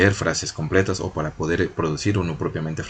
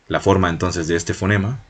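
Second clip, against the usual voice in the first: normal talking voice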